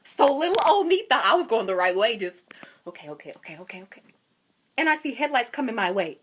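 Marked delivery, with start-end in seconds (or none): 2.9-4.0 very soft, repeated okays; nervous self-talk. Retold or imitated. Lost driver reassuring herself, very quiet. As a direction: coax yourself along under your breath.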